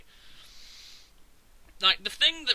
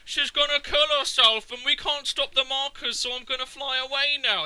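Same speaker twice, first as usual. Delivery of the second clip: higher, lightly put-on dopey voice; mock dick face character; 7 semitones higher, 4 dB louder than usual. Mocking the game character's plan, a dopey put-on voice. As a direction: play the character as a self-important twit.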